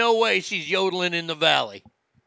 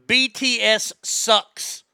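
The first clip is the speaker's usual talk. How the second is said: louder, slow, each letter of "B T S sucks" punched; emphatic BTS sucks; 5 semitones higher, 5 dB louder, much slower than usual. Real, not acted. Declaring his opinion of the band, loud and slow. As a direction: spell out "B T S" slowly, then hit "sucks". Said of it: "Let me just say it right now".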